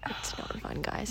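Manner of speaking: unvoiced whisper, dropping the act; whispered correction. Real, not acted. Host whispering the truth about periods to listeners. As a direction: confide it under your breath. Correcting her sarcastic remark.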